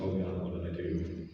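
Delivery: low, soft retold worry; hushed, low-pitched self-questioning; quiet dread. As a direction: say it small and low, as if in trouble. Retold dread after disappointed look.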